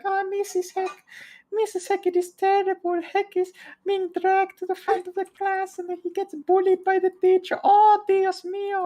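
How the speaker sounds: mock-fret like a flustered foreign assistant; fretting teaching assistant. Teaching assistant pulling mother aside, high mock-fretful voice. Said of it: "the teaching assistant would be like".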